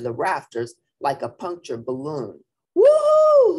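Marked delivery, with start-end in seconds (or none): 2.7-3.6 very high, loud whoop; hooted cheer. A narrator's reading. Crowd hooting at the trapeze catch, whooping. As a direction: hoot it out like a thrilled crowd. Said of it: "hooted the crowd".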